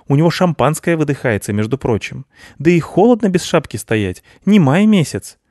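fussing and rushing, exasperated; impatient Snegurochka. Acted. Snegurochka explains to the cats in a hurry, high impatient voice.

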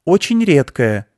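put-on high, loud, slow firm girl; a small girl bluffing a hunter; piping stern insistence; girl insisting.

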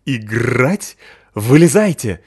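playful dog growl. Acted. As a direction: bark it out like an eager big dog. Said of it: "весело рычал он вслед". (весёлый рычащий собачий голос)

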